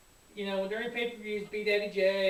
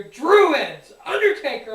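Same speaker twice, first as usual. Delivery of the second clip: loud yelled names, pitched up, a laugh at the end; excited shout; 4 semitones higher, 13 dB louder, slower than usual. Retold or imitated. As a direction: shout it up the stairs, thrilled. Friend yelling from downstairs.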